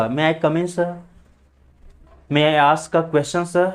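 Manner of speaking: "sir" ending in an uh vowel, r dropped; silent final r. Shown as a demonstration. Teacher demonstrating classroom requests, "sir" without its r. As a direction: model the phrases with "sir" said as "suh". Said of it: "ठीक है ना, ऐसे स".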